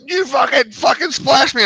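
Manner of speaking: high, indignant mock yell at normal volume; retold angry yelling. Splashed boater complaining to the driver, high mock yell. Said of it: "he kept yelling at me about it".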